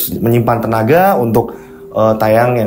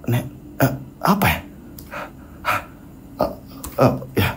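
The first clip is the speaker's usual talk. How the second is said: struggling to speak; 10 dB quieter than usual. Acted. Husband tries telling his wife, halting and dazed. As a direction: falter between long pauses, lost for words. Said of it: "ingin menceritakan, tapi kayak susah".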